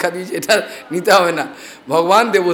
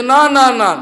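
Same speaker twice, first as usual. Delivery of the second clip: firm refusal; 6 semitones higher, 4 dB louder, slower than usual. Acted. King stops the demons.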